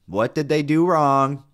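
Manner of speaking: escalated scolding question. Real, not acted. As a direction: hammer the question slowly and loudly. Repeats scolding with more force.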